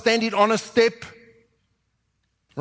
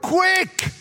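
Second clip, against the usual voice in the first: sudden high excited cry; eager high-pitched command; urgent call; 11 semitones higher than usual. Shown as a demonstration. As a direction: snap the servants into action. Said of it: "I love that word".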